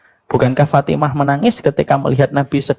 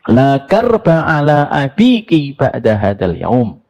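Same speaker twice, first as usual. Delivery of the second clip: slow tender quote; 3 semitones higher, 3 dB louder, slower than usual. Retold or imitated. Quoting Prophet's whisper to Fatimah.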